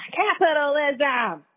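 high, stretched-out cry of capitalism; drawn-out exclamation. Real, not acted. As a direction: draw the word out high, then let it fall. Word repeated in mock exclamation.

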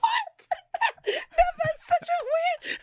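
high laughing what; laughing disbelief. Real, not acted. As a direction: burst out laughing in shock.

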